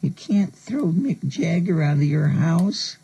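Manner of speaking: high, slow, squeaky little-fan voice; timid little squirrel. Retold or imitated. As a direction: play the awed little kid in the corner, pleading. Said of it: "this little squirrel in the corner going".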